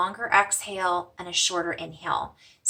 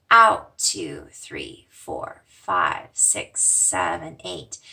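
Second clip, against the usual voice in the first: counting the long exhale; 14 semitones lower, 5 dB louder, much slower than usual. Shown as a demonstration. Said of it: "remember to breathe".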